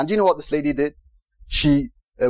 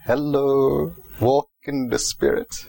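a gentle inner voice nudging you; pastor's voice in your head; 2 semitones lower, slower than usual. Pretend. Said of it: "You hear Pastor Benson's voice saying".

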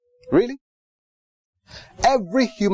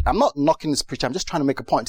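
ordinary delivery

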